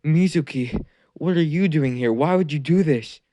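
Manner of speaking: put-on a betrayed boy who can't believe it; shocked Naruto. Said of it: "Naruto's like".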